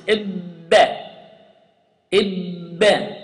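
إب with the ba popped and echoing; bouncing ba. Shown as a demonstration. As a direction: release the ba with a bounce. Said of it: "it is like this".

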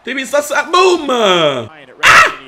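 high, loud yell into a boom; battle yell. Real, not acted. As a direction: roar it as the hit lands. Shouting at the opponent in game.

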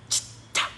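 Chít, chà said unvoiced with hissed sibilants; hissing English sounds. Shown as a demonstration. Mocking English sounds, whispered Chít, chà. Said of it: "phải phát âm phải có xì, xa".

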